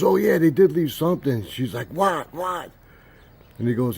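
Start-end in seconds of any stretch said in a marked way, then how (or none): 0.0-1.4 sudden recollection. Retold or imitated. Grandfather suddenly recalls in acted quarrel. 1.9-2.7 high, urgent demand; high-pitched, desperate to know; frantic eagerness. Retold or imitated. Cousin frantic to hear the answer.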